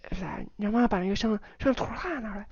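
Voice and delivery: hammed-up fast talker gabbling the order; mock fast speaker. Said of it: "Nobody would talk that fast".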